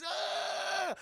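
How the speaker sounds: high, drawn-out mock scream; pained scream. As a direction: wail as if scalded, without extra volume. Coffee falls on man's face.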